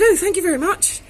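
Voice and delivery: high, loud burst of relieved thanks; relieved gush. Retold or imitated. Barmaid gushing thanks to tough regular, high and loud. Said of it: "And I said".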